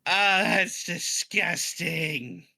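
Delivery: disgusted. Acted. Character disgusted after seawater.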